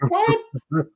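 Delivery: high-pitched mock disbelief; Magic incredulous. Star player reacting to coach's yelling, incredulous. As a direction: squeak it, as if affronted.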